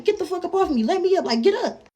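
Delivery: high, urgent retold yell. Pinned girl to boy on top of her, retold yelling. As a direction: act out yelling to be let up. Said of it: "I'm yelling at him".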